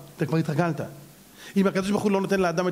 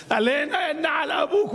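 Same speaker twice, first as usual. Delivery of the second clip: mock madman shout; 9 semitones higher than usual. [name] acts crazy.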